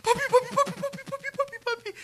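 put-on very high, wailing child's cry; little girl crying papi. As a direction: imitate a small daughter sobbing for her dad. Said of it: "Mía tiene una manera de llorar bien curiosa".

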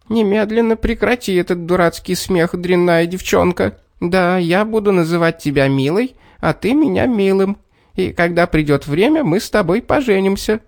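high, shrill squeal of rage; squealing angry little man. A narrator's reading. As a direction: squeal it in a tiny fury. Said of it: "заверещал".

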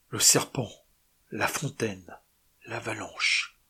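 usual speaking voice

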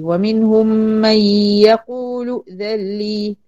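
slow chanted ومنهم من يقول ائذن لي, long held sounds, hamza straight after يقول; joined recitation. Shown as a demonstration. Teacher recites the verse to show the linking, slow chant. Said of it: "فقلت ايه؟".

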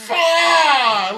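sudden loud, high curse; frustrated outburst. Retold or imitated. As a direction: burst out in disbelief. Retold outburst at the customer.

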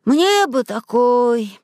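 slow, high wistful wish; envious little boy. A narrator's reading. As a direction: draw it out with longing. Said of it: "завистью сказал Зайчик".

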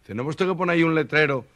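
usual speaking voice

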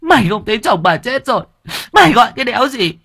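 high, fast, loud screech; furious outburst. Acted. Mother screams at her son for interrupting her grief. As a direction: lash out at the son through tears.

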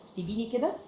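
ordinary talk, nothing marked

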